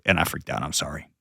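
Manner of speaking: low, very soft apology; choked up. Real, not acted. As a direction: let the apology sink almost to nothing. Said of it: "I'm gonna get all choked up".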